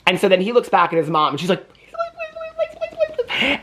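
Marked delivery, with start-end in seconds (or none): 1.7-3.6 tiny, very high squeak, much softer; hammed-up begging under the breath in falsetto; mock pleading. Mom begging her little boy at court, squeaky mock plea.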